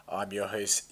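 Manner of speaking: ordinary talk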